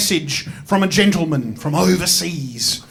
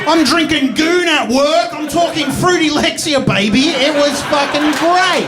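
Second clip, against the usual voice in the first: hammed-up swagger; 6 semitones higher than usual. Montage-style hype.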